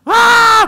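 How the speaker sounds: high, loud screamed wail; hammed-up toddler shriek; mock child scream. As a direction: imitate a child's screaming tantrum. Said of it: "harus teriak".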